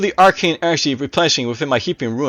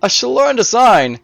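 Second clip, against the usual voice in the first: high-pitched villain delighted by a discovery; intrigued Necromancer; 6 semitones higher than usual. A narrator's reading. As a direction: ring out high as a fascinated necromancer. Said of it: "a remark rang out".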